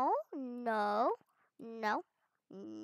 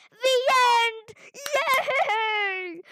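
higher, louder cheer with a laugh; joyful cheer; 9 semitones higher, 8 dB louder, much slower than usual. Real, not acted. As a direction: shout for joy at winning. Reaches end of obby.

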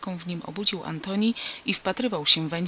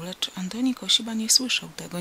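usual speaking voice

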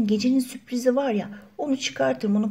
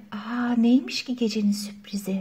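whisper; 5 dB quieter than usual. Acted. Curious aside to friends.

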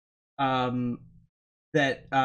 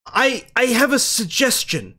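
high, rushed wait-wait with a nervous laugh; frantic pleading; 8 semitones higher than usual. Acted. Cornered doctor pleading with his captors, high and frantic. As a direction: stop them in a panic. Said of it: "and he says".

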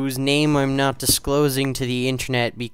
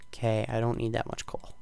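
ordinary talk

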